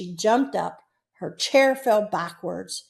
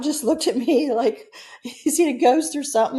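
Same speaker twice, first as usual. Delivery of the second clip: laughing while telling; 4 semitones higher than usual. Real, not acted. Storyteller recounting the boy's reaction, laughing. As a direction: talk through a real laugh.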